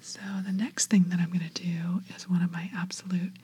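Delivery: ASMR whisper. Shown as a demonstration. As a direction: whisper gently, like soothing ASMR. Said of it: "whisper into your ear".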